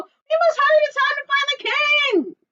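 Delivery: high urgent cry. Acted. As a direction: urge them on loudly. Chicken Little urges.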